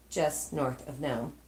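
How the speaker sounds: plain reading voice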